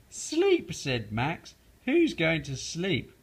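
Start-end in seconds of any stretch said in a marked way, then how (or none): 0.1-0.7 much higher incredulous echo; mock the idea of sleeping; scoffing Max. Max scoffing at Froggy, incredulous. 1.8-3.1 slow, higher scoff; scoffing question. Acted. Max teasing Froggy, slow scoff.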